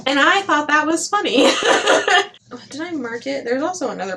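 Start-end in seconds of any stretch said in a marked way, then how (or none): 1.5-2.3 high burst of giggling; high giggle. Real, not acted. Laughs after the joke.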